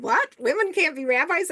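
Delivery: high, incredulous "What?" and rising "Women can't be rabbis?"; shocked disbelief. Retold or imitated. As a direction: replay the stunned outrage of your younger self. Indignant disbelief at seminary's refusal.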